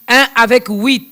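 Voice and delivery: loud, high, slow spelling-out; emphatic self-correction. Real, not acted. Host correcting her own wrong date, loud emphatic self-correction. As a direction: drum the correct figure into your own head.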